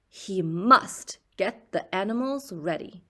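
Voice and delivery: plain reading voice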